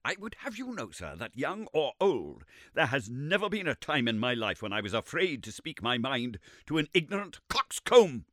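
put-on a touch of an indignant old man, "coxcomb" stressed; defiant retort. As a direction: stand up to him. Summerlee retorting to Lord John.

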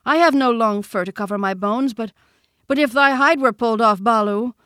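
high, loud protest; indignant boy. A narrator's reading. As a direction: argue back as an affronted child. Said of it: "said Mowgli indignantly".

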